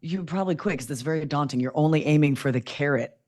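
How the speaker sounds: usual speaking voice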